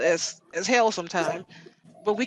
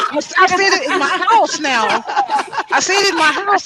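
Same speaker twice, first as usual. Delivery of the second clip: pitched up and laughing through it; 10 semitones higher, 8 dB louder than usual. Real, not acted. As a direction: giggle out the confession, louder. Laughing confession about slipping.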